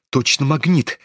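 ordinary delivery, nothing marked

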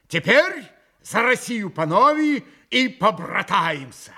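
loud, high, exuberant toast; rally everyone to drink as brothers; восторженный тост. A narrator's reading. Mitya proposes the next toast. (громко и восторженно)

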